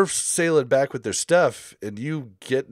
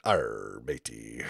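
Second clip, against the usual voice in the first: much lower, softer pirate growl; 13 semitones lower, 9 dB quieter, much slower than usual. Pretend. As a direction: growl it like an old sea dog. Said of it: "even just talking like a pirate".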